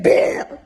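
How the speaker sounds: unvoiced scare burst; ghost boo. Imagined ghost jumping out in mirror, a voiceless boo. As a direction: jump out with a breathy boo. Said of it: "come out to you like".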